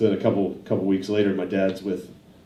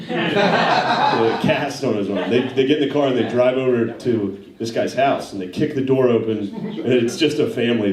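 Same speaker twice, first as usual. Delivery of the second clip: ordinary delivery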